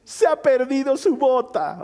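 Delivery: small daughters owning up to their dad, timid; little girl voice. Retold or imitated. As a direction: confess like a guilty little girl, slow at the end. Daughter's voice again.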